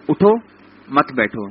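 اٹھو rising, a pause, then مت بیٹھو falling; pause after اٹھو. Shown as a demonstration. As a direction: break the phrase right after اٹھو. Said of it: "وقف میں نے کدھر کیا؟ اٹھو پہ کیا".

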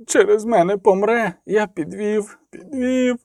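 tearful self-blame, dying away; sobbing. A narrator's reading. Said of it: "схлипнув він".

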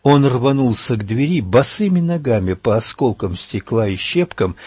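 plain reading voice

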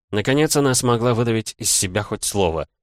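plain reading voice

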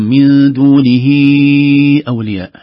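slow, loud chanted recitation; Quran recitation. Shown as a demonstration. Teacher reciting a verse for students in chanted style. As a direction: intone it like tajweed reading.

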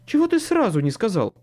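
squeaky alarm from a frightened man; panicked squeak. A narrator's reading. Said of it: "панически пискнул второй мужской голос".

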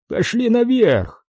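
high, drawn-out forceful order; curt command. A narrator's reading. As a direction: order the captive along, voice pitched high. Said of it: "отрезал Уолтон". (властный приказ, высоко и протяжно)

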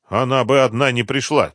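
ordinary delivery, nothing marked

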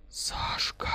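astonished soft whisper. A narrator's reading. Лиза sees Сашка at the door, whispering. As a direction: whisper the name, drawn out. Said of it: "прошептала она".

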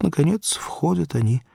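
ordinary delivery

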